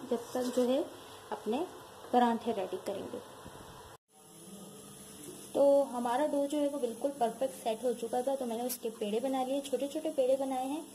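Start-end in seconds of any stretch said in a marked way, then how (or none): none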